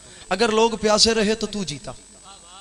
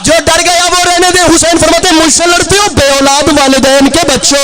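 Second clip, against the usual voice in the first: impassioned preaching; 8 semitones higher, 16 dB louder than usual. Acted. Preacher hurling Husain's challenge at the enemy, impassioned. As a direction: call out to the crowd with full force. Preacher back at full force.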